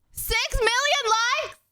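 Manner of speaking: high, incredulous exclamation; shocked disbelief. Real, not acted. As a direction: blurt it out high-pitched, stunned by the number. Stunned at a huge like count.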